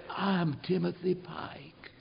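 barely voiced whisper of a sick man; weak hospital-bed whisper; faint whisper. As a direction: breathe the words out, almost no voice. Dying son's faint reply.

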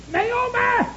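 high-pitched holler for a wife. Retold or imitated. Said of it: "and he hollered".

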